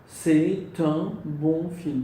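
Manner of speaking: very slow, lean on "bon"; "C'est un bon film" stretched out syllable by syllable; slow model sentence. Shown as a demonstration. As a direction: spell it out slowly for students. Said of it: "À quelle syllabe?".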